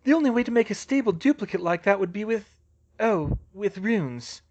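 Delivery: lightly voiced dawning realisation; Hermione realising.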